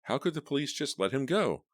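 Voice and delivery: high, outraged question; can't believe it. A narrator's reading. Roxy protesting.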